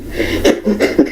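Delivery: breathy high cackle; high laughing fit. Real, not acted. Laughing at his impression.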